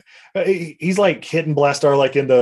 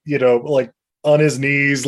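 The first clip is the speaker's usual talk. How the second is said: ordinary talk, nothing marked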